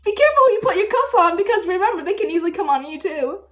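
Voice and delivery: loud, fast gloating tease; teasing the boy about his own snake cuffs, loud and fast; teasing warning. Retold or imitated. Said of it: "I was like".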